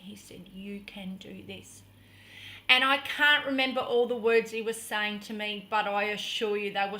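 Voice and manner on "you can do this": low, whispered encouragement; hushed, gentle coaxing; reassuring. Retold or imitated. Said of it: "he just kept reassuring me".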